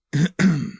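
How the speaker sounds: loud, deliberate throat-clearing; pointed throat clear. Acted. Host hinting at spoilers to viewers, pointed throat clear.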